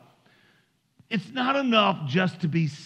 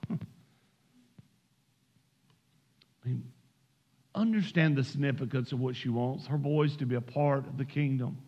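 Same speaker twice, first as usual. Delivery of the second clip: low, soft, measured pulpit voice; hushed earnest preaching; 5 semitones lower, 6 dB quieter than usual. Real, not acted. Preacher to struggling moms about their children, hushed and earnest. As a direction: bring it right down, tender and grave.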